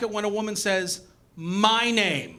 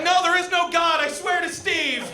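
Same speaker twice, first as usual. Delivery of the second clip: pleading partner; 10 semitones higher than usual. Retold or imitated. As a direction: swear desperately. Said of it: "She's like".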